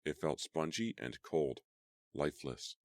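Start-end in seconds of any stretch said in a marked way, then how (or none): none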